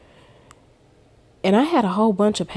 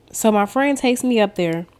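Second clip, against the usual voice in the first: ordinary delivery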